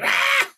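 short high-pitched mock shriek; imitated Green Goblin scream. Co-host imitating Green Goblin attacking in the video, mock shriek. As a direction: let out a quick screechy attack cry. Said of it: "It's like".

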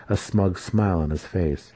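usual speaking voice

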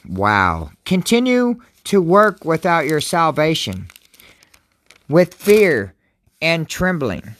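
scripture reading. Acted. Pastor reading Philippians to his viewers, solemn and slow. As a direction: read each phrase as holy words.

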